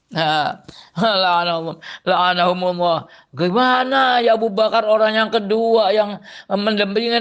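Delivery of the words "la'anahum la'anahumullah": ordinary talk, nothing marked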